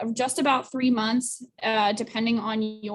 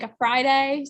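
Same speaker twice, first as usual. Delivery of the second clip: ordinary delivery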